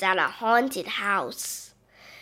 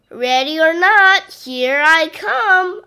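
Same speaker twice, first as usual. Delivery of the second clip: calling out; 6 semitones higher, 7 dB louder than usual. Acted. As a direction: call it out across the dark room. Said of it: "I say".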